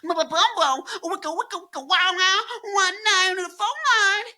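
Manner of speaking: high, loud nonsense rap scatting; hammed-up nu-metal frontman gibberish. Imitating a Limp Bizkit singer for fun, high mock rap. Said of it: "I was doing [name]".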